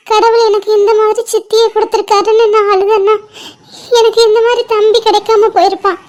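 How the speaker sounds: ordinary talk, nothing marked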